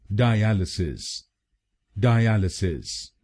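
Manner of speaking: डायलिसिस slowly as die-AL-uh-sis; slow correct pronunciation. Shown as a demonstration. Teacher repeats the whole word slowly, die-AL-uh-sis. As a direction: stress AL in डायलिसिस, drawn out. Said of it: "तो बनेगा डायलिसिस".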